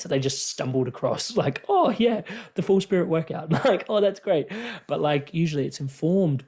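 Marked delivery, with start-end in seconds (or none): 1.7-4.5 mock breezy enthusiasm. Mocking a casual discovery.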